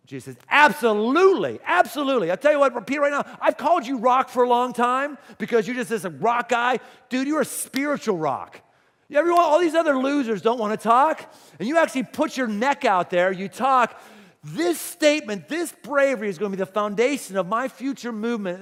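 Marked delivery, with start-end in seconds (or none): 0.5-8.6 loud, high, emphatic affirmation; acted-out excited endorsement; emphatic approval. Jesus acted as delighted with Peter. 9.1-18.6 loud, raised, rousing declaration; single him out with forceful, excited praise; acted-out fired-up commendation; emphatic praise. Jesus acted, praising Peter's bravery.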